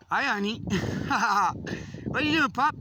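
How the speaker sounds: sing-song greeting to a beloved dog; talking to the dog. Real, not acted. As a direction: coo at the pup with delight.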